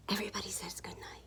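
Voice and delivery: quick, very soft whisper; barely audible storyteller whisper; hushed narration. A narrator's reading. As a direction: whisper it as everyone goes to sleep. All settling down to sleep.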